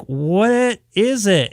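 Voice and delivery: mock-frustrated questioning; retold exasperation. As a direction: draw it out, getting fed up. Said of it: "and I'm getting pissed".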